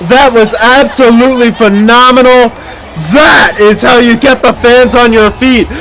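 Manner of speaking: amped-up arena play-by-play; sports commentary. Acted. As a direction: call it like a live announcer. Live play-by-play over show footage.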